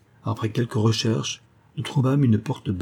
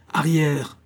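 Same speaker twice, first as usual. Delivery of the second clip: high yelled command to get back; yelled warning; 6 semitones higher than usual. A narrator's reading. The hostess waves her stick at the men, high yelled warning. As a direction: drive them back with a high yell. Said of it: "hurla-t-elle".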